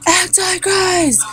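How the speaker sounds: high, loud, slow fanatic shouting; preacher screaming. Retold or imitated. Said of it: "screaming his mouth off".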